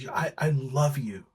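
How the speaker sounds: imitating a breathless fan's whisper; hushed mock-fan confession; starstruck fan. Starstruck fan quoted in story.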